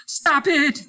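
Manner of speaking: very high, loud desperate shout; yell. Acted. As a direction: shout to stop the screaming. Hollis yells at screaming man.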